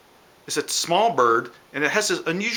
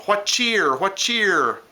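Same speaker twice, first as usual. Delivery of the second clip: higher, lilting bird-call voice; cardinal call imitation; 6 semitones higher, much slower than usual. Teacher imitating a cardinal for the class, higher bird-call voice. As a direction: chirp it out like a cardinal. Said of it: "Cardinals sound like they're saying".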